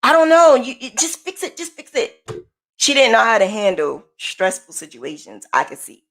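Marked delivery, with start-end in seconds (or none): 0.0-2.3 frustrated. Retold or imitated. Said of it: "she was getting frustrated and she was like".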